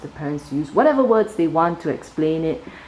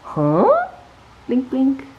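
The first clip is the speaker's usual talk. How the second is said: surprised huh; 10 semitones higher, much slower than usual. A narrator's reading. Eyes in dark wake.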